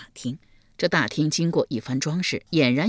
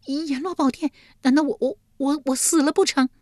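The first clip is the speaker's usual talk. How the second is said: very high stammering panic; shocked fright; 10 semitones higher, 3 dB louder than usual. A narrator's reading. Yulian fearing she is dead, shocked fright. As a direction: stammer in high-pitched alarm. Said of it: "玉莲啊了一声道".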